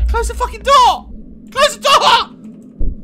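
loud, high shout between laughs; panicked yell. Real, not acted.

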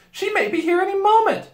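put-on very high, loud girl; anxious Susan. A narrator's reading. Girl warning of the witch, high and anxious. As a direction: warn urgently in a high voice.

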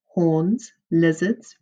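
normal talking voice

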